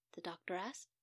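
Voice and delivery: plain reading voice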